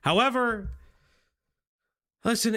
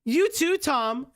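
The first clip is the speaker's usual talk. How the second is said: voicing Rowan; 7 semitones higher, slower than usual. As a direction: chirp it back brightly high. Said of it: "do you wanna be Rowan".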